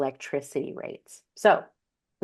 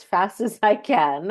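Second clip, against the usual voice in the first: laughing; 5 semitones higher, 6 dB louder than usual. Real, not acted. Speaker laughs at own rushing.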